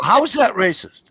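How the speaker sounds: loud, high retold objection; indignant protest. Worker protesting to boss in retelling, indignant. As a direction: push it out loud and high, incredulous.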